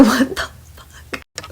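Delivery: drawn-out, incredulous whisper; whispered disbelief. Retold or imitated. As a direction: whisper it slowly, as a retold reaction. Stunned aside in retold quote.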